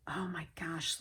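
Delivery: awed whisper. Real, not acted. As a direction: murmur it almost voiceless. Hushed awe at pretty items.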